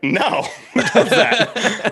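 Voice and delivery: high, slow, laughing through the words; laughing disbelief. Retold or imitated. Friends laughing off his shower habit, incredulous laugh. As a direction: scoff at the idea while cracking up. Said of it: "They were like".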